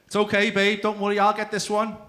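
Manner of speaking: high, loud show of willingness; loud mock reassurance. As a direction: call it out high and loud to your partner. Acted night-time offer to partner.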